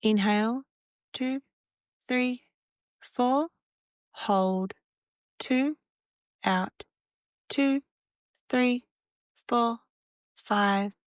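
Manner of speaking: calm counted breathing guide, even and unhurried; guided meditation. Acted. Meditation guide counting breaths for listeners, calm and even.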